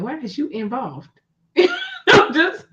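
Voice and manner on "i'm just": high, loud burst of laughter; laughing. Real, not acted. Laughing hard at the friend's teasing. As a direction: laugh it out, can't finish.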